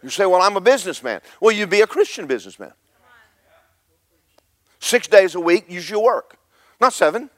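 loud, high, driving sermon voice; fiery revival preacher; preaching at full force. Acted. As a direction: preach it hard to the room.

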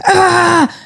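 short high exasperated shriek; mock bedtime yell, very high; frustrated yell. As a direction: burst out in a sharp high cry at bedtime. Mum snapping at bedtime.

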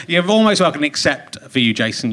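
usual speaking voice

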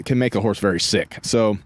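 normal talking voice

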